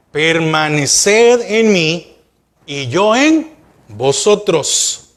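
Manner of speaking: "Permaneced en mí y yo en vosotros" drawn out word by word; slow, weighted verse reading. Shown as a demonstration. Pastor reads the verse aloud to his church, slow and deliberate. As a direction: spell out every word of "Permaneced en mí y yo en vosotros" so the congregation hears it. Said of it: "oiga cómo dice".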